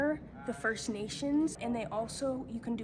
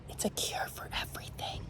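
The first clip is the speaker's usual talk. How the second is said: whispered joke; 8 dB quieter than usual. Pretend. Joking aside about the healing water.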